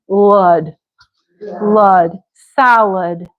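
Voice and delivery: "lud" with a short uh, then salad joined; reduced second vowel. Shown as a demonstration. Teacher shows two A's differ, slow syllable demo. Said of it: "don't say the second one the same way".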